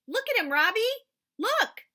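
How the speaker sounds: high, loud thrilled scream; boy screaming to his friend. Acted. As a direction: shriek it while jumping. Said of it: "I screamed".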